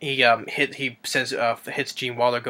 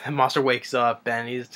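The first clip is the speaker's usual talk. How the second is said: ordinary delivery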